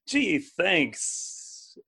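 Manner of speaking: a dry, unimpressed gee thanks; mock the grudging thank-you; sarcastic thanks. [name] imagined opening a bad gift, dry sarcasm. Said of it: "disappointed or angry or frustrated".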